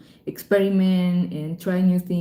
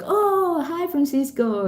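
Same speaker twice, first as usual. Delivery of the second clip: excited greeting; 8 semitones higher, slower than usual. Retold or imitated. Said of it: "they were like".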